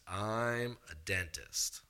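low, slow, quiet deadpan; deadpan dentist. Retold or imitated. The dentist answering Jeremy, low and slow deadpan. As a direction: drop it flat and slow.

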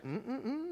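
soft closed-mouth "mm-mm-mm"; imitated refusing hum. Wife refusing to bail out husband, imitated refusing hum.

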